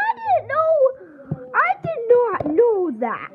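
squeaky amazed voice; surprised high voice. Acted. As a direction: gasp it out in a high voice. Voicing library character.

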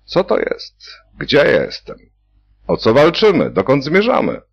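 high, bewildered asking; dazed patient after surgery; dazed questioning. A narrator's reading. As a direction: ask foolishly, still in shock. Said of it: "dopytywał się głupio".

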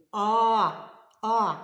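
loud, open «А», said twice; two held, separate «А» sounds; isolated vowel. Shown as a demonstration. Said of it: "А посерединці який звук?". (два гучні протяжні «А»)

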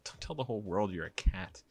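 plain reading voice

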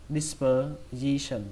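"Dispersion" very slow, in separate parts; slow syllable-by-syllable "Dispersion"; slow broken-up model. Shown as a demonstration. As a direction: pause inside "Dispersion" between per and sion. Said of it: "Các bạn đọc nhanh hơn".

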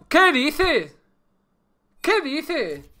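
high-pitched, drawn-out disbelief; incredulous exclamation. Real, not acted. Disbelief at failed game start. (exclamación aguda de incredulidad)

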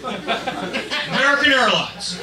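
loud, high patriotic rant; belligerent, hammed-up patriot yelling at the driver; true US patriot. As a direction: shout it with outraged national pride. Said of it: "U S patriot".

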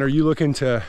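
ordinary delivery

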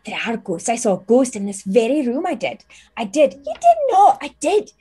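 put-on a giddy teenage maid insisting; excited teen maid. GM voicing maid Teresa to her friend, high and giddy.

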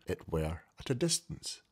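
plain reading voice

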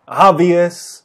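ordinary talk

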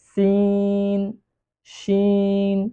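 সিন and শিন held long, three alif; long sin and shin. Shown as a demonstration. Teacher showing the class long সিন and শিন. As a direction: draw out সিন then শিন. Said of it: "তিন আলিফ পরিমাণ টান দিয়ে পড়ব".

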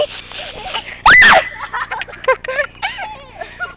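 high shrieking laugh. Real, not acted. Sprayed, laughing hard.

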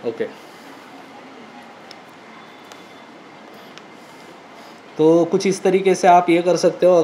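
muffled behind cloth and helmet; through balaclava inside helmet. Real, not acted. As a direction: mouth covered by fabric. Seen 1: Full-face helmet on, visor up, balaclava covering mouth and nose throughout.

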